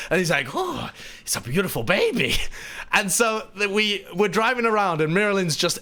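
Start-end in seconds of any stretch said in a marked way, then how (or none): 0.5-2.3 put-on awed Latvian-accented gasp and coo; Miralyn's Latvian accent. Latvian guest answering the driver, smitten accented coo. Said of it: "this high tone and in this amazing accent".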